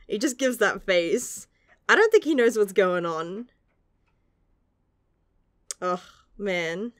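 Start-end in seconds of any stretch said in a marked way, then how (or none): none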